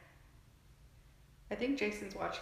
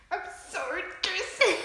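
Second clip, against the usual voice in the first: high, strained forced apology; through clenched teeth; 12 semitones higher, 6 dB louder than usual. Retold or imitated. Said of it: "Through clenched teeth".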